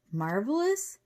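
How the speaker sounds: MARVELOUS as a rising question; incredulous echo. A narrator's reading. Cheyenne doubts professor's delight, incredulous rising MARVELOUS. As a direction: echo MARVELOUS in disbelief, pitch climbing.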